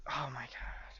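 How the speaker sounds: exasperated whisper under breath. Real, not acted. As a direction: breathe it out, fed up. Exasperated aside.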